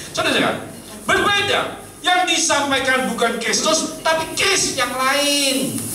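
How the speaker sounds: high, loud warning from the pulpit; ringing sermon warning; forceful preaching. Real, not acted. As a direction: proclaim it with force, drawing out the last phrase.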